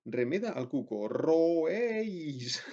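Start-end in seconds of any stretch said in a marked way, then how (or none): 1.1-2.6 a mild mock call from someone in the street; street vendor's cry. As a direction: call it out like a vendor imitating a cuckoo. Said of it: "alguien grita". (grito de vendedor imitando al cuco, suave)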